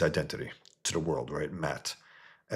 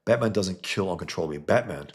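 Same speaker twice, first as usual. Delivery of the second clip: normal talking voice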